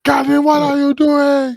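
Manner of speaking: high, loud in-character scream; Waylon screaming. Acted. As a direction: shout the question at the top of the voice. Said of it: "that's what you hear Waylon scream".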